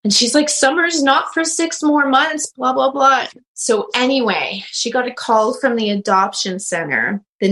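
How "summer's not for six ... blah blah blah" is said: mock protest. Retold client's objection, dismissive blahs.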